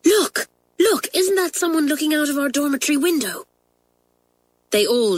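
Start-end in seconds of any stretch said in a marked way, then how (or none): 0.0-3.5 much higher, excited hushed cry; loud whisper. A narrator's reading. Pat spotting a girl at the window, excited whisper. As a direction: point it out in a breathless rush. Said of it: "whispered loudly".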